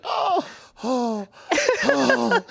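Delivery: high, stunned little gasps; taken completely off guard; relieved surprise. Retold or imitated. A customer stunned the rude cook is kind, high surprised oh. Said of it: "And I was like".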